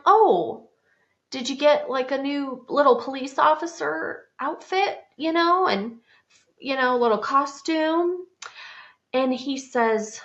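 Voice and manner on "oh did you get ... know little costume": high, sing-song pretend interest; feigned innocence. Handcuffed mom asking her son about a costume, feigned innocence. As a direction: chirp innocently, pitched well above normal.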